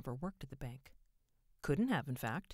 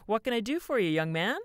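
loud, higher, salesman-polite greeting; polite shopkeeper; 8 semitones higher, 11 dB louder than usual. A narrator's reading. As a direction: greet a possible customer sweetly. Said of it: "in the polite voice he reserved for potential customers".

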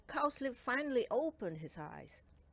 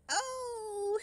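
a sharp, much higher startled oh; surprised exclamation. A narrator's reading. Cowslip the gnome startled to meet the elves, a high surprised cry. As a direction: gasp it out in surprise. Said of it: "exclaimed Cowslip".

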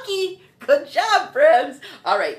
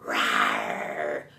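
long, low lion roar; deep pretend big-cat roar; 5 dB quieter than usual. Shown as a demonstration. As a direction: draw out a soft lion's rumble. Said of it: "That's a lion".

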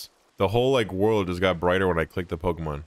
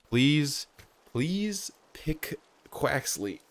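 slow, much softer begging; hushed plea; 4 semitones higher, 5 dB quieter, much slower than usual. Real, not acted. Player begging the rival to pick Quaxley, slow hushed plea. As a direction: plead under your breath, word by word.

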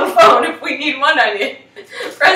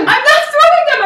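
loud, very high-pitched denial; indignant protest; 6 dB louder than usual. Real, not acted. Cook objects to being told off, indignant protest. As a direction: protest loudly in a high, squealing voice.

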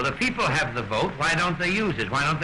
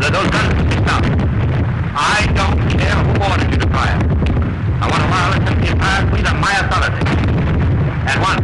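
firm command; 3 semitones higher, 5 dB louder than usual. Acted. King orders ceasefire by phone.

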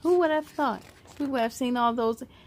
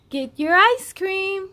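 louder, higher, slower call; mom shouting; 8 semitones higher, 6 dB louder, slower than usual. Acted. Peyton's mom calling the children for ice cream, shouting. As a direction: call the kids over from afar. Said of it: "shouted Peyton's mom".

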